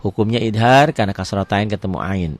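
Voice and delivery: normal talking voice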